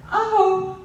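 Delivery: high mocking mom voice. Mom making excuses to angry daughter, high mock. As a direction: lightly imitate mom, up high. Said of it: "And my mom says".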